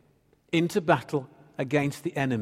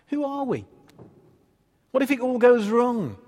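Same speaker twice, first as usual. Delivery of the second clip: doubting voice; 8 semitones higher than usual. Acted. Voicing the doubting inner thoughts.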